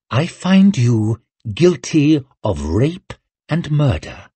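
slow, icy verdict. A narrator's reading. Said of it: "in the same icy tone".

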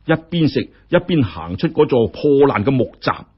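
plain reading voice

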